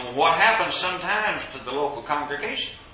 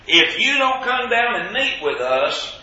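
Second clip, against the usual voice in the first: higher, slow, insistent warning; pitched-up, drawn-out threat; acted threat; 9 semitones higher, 5 dB louder than usual. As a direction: lay down the ultimatum slowly. Acts out the enemies' threatening letter.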